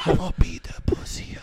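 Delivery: breathy mock ASMR whisper with giggles. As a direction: whisper it, barely holding in laughs. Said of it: "We're on the whisper portion".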